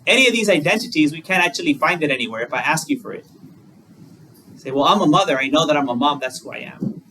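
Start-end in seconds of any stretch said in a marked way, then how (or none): none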